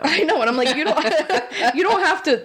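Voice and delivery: laughing through it, pitch climbing; giggling while retelling a plea to the mail lady. Real, not acted. Telling a friend about the mail lady, laughing.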